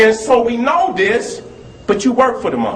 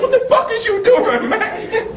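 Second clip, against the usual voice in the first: laughing mock outrage; 12 semitones higher than usual. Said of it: "I said".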